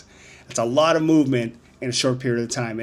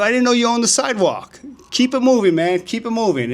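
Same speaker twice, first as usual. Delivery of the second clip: much higher, louder sarcastic dismissal; scornful brush-off; 9 semitones higher, 3 dB louder than usual. Retold or imitated. Telling the sidewalk guy to move along, loud sarcastic brush-off. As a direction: wave him off with scorn. Retold sidewalk quarrel with stranger.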